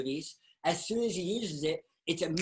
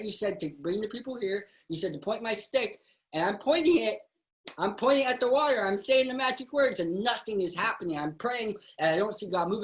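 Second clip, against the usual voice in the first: higher-pitched, insistent protest to God; frustrated pleading; 6 semitones higher than usual. Acted. Believer tells God nothing is happening, pleading in frustration. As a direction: plead your case as prayers go unanswered.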